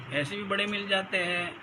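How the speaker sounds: usual speaking voice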